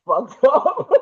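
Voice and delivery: a rueful laughing I've messed up; laughing dismay. Retold or imitated. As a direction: chuckle through the realization. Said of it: "And I was just like".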